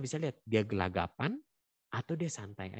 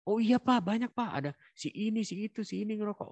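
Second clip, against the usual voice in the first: put-on child answering; 11 semitones higher than usual.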